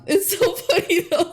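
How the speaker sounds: laughing high and bright through the words; real laugh breaking through. Real, not acted. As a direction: giggle it out, really amused. Laughing at the scene.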